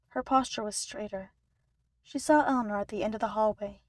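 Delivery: usual speaking voice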